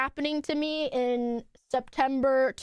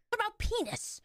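ordinary delivery

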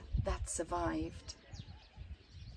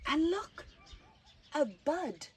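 wonder; 9 semitones higher, 4 dB louder than usual. Acted. Wonder at the bud.

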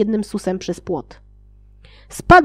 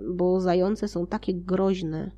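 dry sarcasm; 2 semitones lower, 8 dB quieter, slower than usual. Real, not acted. Reader's sarcastic aside on hares.

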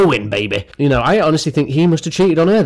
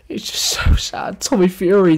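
whispered, high fake sob; hammed-up grief whisper; mock sad; 7 semitones higher than usual. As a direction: pretend to be heartbroken, breathy and whiny. Mock grieving over celebrity breakup.

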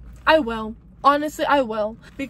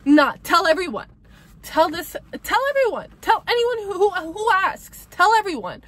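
emphatic urging; 6 semitones higher, 5 dB louder than usual. Real, not acted. Urging viewers, voice rising.

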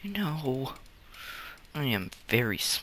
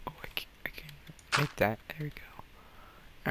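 whisper to self; 2 semitones higher, 8 dB quieter than usual. Real, not acted. Crafting quietly.

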